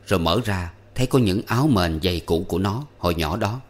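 plain reading voice

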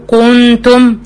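كنتم with the noon's ghunna rounded toward a waw; wrong rounded ghunna. Shown as a demonstration. Teacher imitating a student's rounded mistake. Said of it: "فبتقول كو".

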